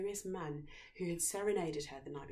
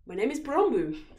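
louder, a touch higher prince; foolish name; 7 semitones higher, 12 dB louder than usual. A narrator's reading. Prince inventing a foolish name for himself. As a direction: announce the silly name proudly. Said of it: "he said".

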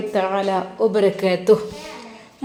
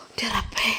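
much softer, breathless sigh; out of breath; 10 dB quieter than usual. Acted. Mother-in-law worn out from restacking wood, breathless sigh. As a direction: gasp it out, spent from hauling firewood. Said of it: "ആകെ കിതക്കുകയാണ്".

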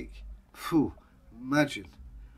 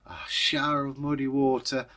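plain reading voice

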